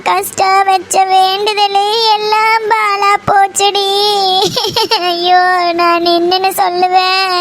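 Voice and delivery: slow, drawn-out tearful wail; crying lament. Acted. Mother telling her daughter all is lost, crying lament. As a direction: wail each phrase out to its end. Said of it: "எதுக்குமா அழுகுற?".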